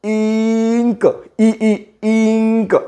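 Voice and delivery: slow इंक with a very long held "ee"; sounding out I. Shown as a demonstration. Teacher answering how I sounds, long stretched इंक. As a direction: tap ई, ई, then draw out इंक. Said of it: "आयचा उच्चार काय होतो?".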